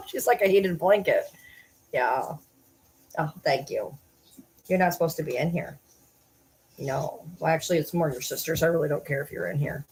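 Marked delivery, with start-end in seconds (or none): none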